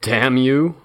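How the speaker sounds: croaked. A narrator's reading. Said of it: "I croaked".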